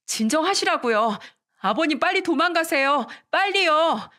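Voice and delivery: high-pitched frantic plea, building; urgent shout. Acted. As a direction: cry out for someone to flee. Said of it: "도망가라고 소리쳤어요".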